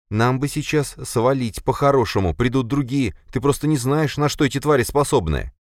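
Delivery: urgent, jittery friend pleading; nervous young man. Acted. Lis voiced higher. (нервный молодой парень)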